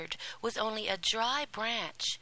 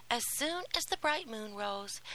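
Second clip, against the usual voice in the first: usual speaking voice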